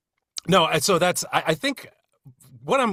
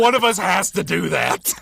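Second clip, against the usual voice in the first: laughing; 6 semitones higher, 5 dB louder than usual. Retold or imitated. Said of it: "I was like".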